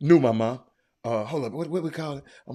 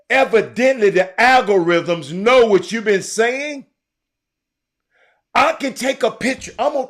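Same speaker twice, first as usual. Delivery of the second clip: louder, insistent ranting; loud agitated rant; worked-up rant; 9 dB louder than usual. Real, not acted. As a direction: hammer the point home to the chat.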